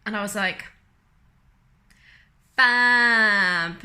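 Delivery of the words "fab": loud, drawn-out sarcastic cheer. Retold or imitated. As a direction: blurt it out in mock delight. Sarcastic reply to bad news.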